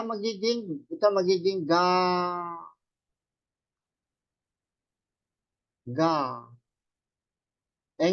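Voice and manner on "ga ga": "ga" with the vowel held long. Shown as a demonstration. Teacher turns girl into Japanese syllables, "ga" drawn out. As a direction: stretch "ga" into gaa. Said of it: "nagiging A".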